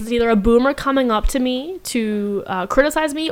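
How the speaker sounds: ordinary delivery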